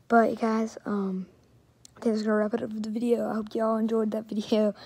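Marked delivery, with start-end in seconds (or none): none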